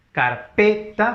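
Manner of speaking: "Carpeta" drawn out slowly, loud stress on PE. Shown as a demonstration. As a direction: slowly sound out car-PE-ta, high on PE. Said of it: "fuerza de voz en la P". ("Carpeta" lento, con fuerza de voz en pe)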